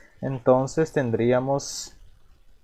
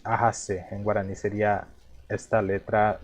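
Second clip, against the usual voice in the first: normal talking voice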